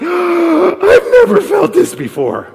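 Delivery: gasp, then a high, loud cry; hammed-up labor wail, slow and tearful; panicked mock wail. First-time mother in natural childbirth, crying out in mock panic. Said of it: "she's probably like".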